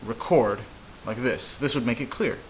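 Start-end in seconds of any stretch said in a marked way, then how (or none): 0.1-0.6 "record" as re-CORD; stress on CORD. Shown as a demonstration. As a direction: hit the end of "record". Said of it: "you could write record".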